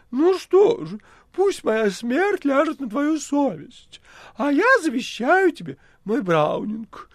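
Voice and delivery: high, loud, mock-tragic cat voice; melodramatic cat. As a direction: overplay a dying cat's lament. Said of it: "проговорил кот".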